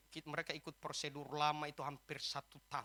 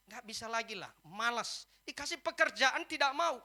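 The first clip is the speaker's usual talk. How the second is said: raised, higher-pitched complaint; retold exasperation; 9 semitones higher, 10 dB louder than usual. Wife tells the pastor she wants to leave, exasperated. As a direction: imitate a fed-up wife complaining loudly.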